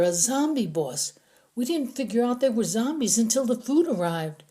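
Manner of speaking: high, anxious captive voice; a scared prisoner explaining to his captors; frightened prisoner. Acted. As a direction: talk fast to save your skin.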